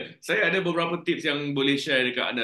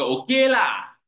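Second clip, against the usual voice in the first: high, loud Malaysian "lah" drawn out; Malaysian slang demo; 9 semitones higher, 5 dB louder, slower than usual. Shown as a demonstration. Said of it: "Slang dia ialah yang la tu".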